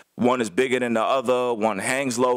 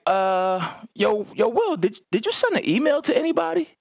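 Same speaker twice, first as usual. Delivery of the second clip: higher-pitched, halting imitation of a worried caller; imitating hesitant coach; 9 semitones higher than usual. Head coach calling his assistant about the email, imitated as hesitant. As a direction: stammer into a rising, high-pitched question.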